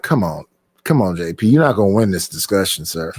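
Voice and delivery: lightly hammed-up low brush-off, a little fast; mock put-down. To J P in the argument, a mock low dismissive brush-off. His own retort to J P.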